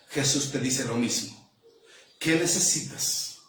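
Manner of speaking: low, measured voice with long pauses; hushed, solemn preaching. Real, not acted. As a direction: bring it right down and speak intimately to each listener. Hushed, slow preaching after climax.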